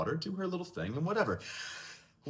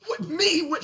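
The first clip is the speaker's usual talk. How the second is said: high, mock-surprised protest; mock surprise; 5 dB louder than usual. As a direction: play the wrongly accused, pitch jumping up. Accused boy protests innocence.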